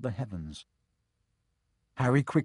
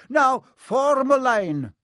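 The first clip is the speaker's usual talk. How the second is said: much higher, louder, slow command; stern professor; 14 semitones higher, 9 dB louder, slower than usual. A narrator's reading. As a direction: order them into line. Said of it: "Professor McGonagall told the first years".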